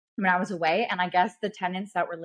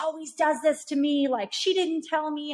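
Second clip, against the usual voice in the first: imitated angry complaint; 7 semitones higher than usual. Seller telling off her own agent, mock aggrieved. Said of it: "reading out her agent".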